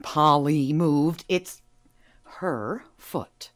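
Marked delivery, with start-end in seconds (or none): 2.2-3.5 whispered, conspiratorial aside. Acted. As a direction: hush it as if sharing a secret. Secretive aside about the goat.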